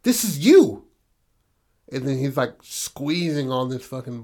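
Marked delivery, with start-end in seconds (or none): 0.0-0.8 fast, rising into a loud, higher snarl; a teen snapping at his dad, getting louder; furious teen lashing out; angry outburst. Retold or imitated. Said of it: "he was just like".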